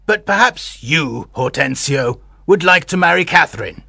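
slyly ironic aged voice; ironic old man. A narrator's reading. Old suitor needling his younger rival, ironic. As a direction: tease drily as an old man. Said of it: "with an ironical smile".

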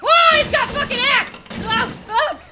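high, loud startled yell; alarmed shout. Real, not acted. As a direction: cry out in shock at an attacker. Startled by enemy with an axe.

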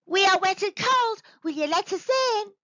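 high, loud shout for help; child's yell. Acted. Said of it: "Seth yelled".

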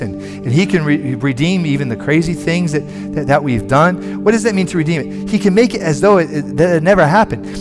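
normal talking voice

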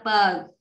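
"bờ" with a tense, tightened initial consonant; taut, stressed "bờ" for a doubled letter. Shown as a demonstration. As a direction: tighten the first sound of "bờ". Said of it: "đọc căng".

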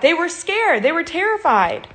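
ordinary talk

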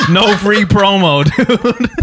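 slow, laughing through it. Real, not acted. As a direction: giggle the words out. Repeats catchphrase laughing.

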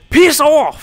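loud, high burst of dismissal; sudden angry shout; sharp yell. Real, not acted. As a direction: shout them away. Chasing off game character.

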